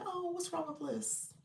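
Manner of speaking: high, soft, worried question; surprised concern. Real, not acted. Host hearing the dog is at the vet, startled.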